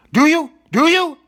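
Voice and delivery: loud, high, pushy repeated question; insistent demand. Acted. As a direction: jab the question at the chicken twice. Pressing the chicken for an answer.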